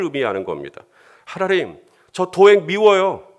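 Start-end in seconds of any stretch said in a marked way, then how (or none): 1.2-3.3 raised, slow, aggrieved plea to God; resentful prayer. Pretend. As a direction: pour out the grudge loudly. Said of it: "이거 솔직한 감정 아닙니까?". (원망하듯 큰 소리로 기도)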